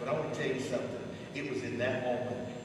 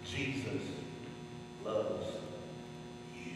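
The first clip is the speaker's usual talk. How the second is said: hushed; 5 semitones lower, 9 dB quieter, slower than usual. Real, not acted. Soft slow after climax.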